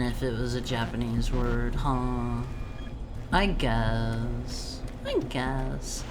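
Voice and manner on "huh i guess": normal talking voice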